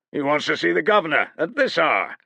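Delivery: mild sneer; scoffing guard. A narrator's reading. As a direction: sneer to your mate.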